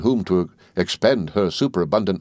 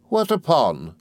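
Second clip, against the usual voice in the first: higher-pitched, mildly sharp question; curt, understated sharp query; 7 semitones higher, slower than usual. A narrator's reading. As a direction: challenge him with a touch of sharpness. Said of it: "said my aunt sharply".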